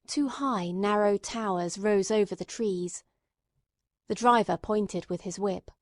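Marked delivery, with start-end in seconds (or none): none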